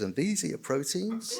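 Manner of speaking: high-pitched mock waiter; lightly imitated server, voice lifted high; fancy restaurant waiter. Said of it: "a fancy restaurant and goes".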